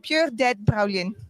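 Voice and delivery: slow Glasgow "Pure dead brilliant"; Glasgow accent. Shown as a demonstration. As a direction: sound like you're from Glasgow. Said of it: "Sound like you're from Glasgow".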